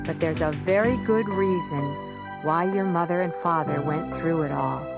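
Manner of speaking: ordinary delivery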